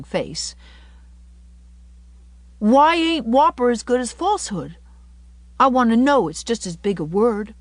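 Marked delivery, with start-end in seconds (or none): 2.6-7.5 put-on high, loud, insistent boy voice; inquisitive little boy. Davy challenges the school ma'am, inquisitive boy. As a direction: demand an answer like a child.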